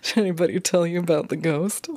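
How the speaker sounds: lightly imitating a smug manager; smug swagger. Manager springing a ghost on new staff, smug. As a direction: tease it out with a touch of swagger. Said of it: "with this smug swagger look".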